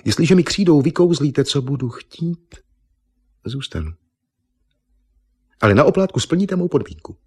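high, coaxing woman's voice; smlouvající Eva. A narrator's reading. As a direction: bargain with him, pitched well up. Eva si klade podmínky. (vysoký ženský hlas, smlouvavě)

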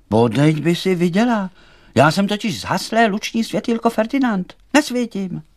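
high, loud little creature voice; high plaintive firefly character; distressed little light. A narrator's reading. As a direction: complain in a high, insistent voice. Snuffed little light laments.

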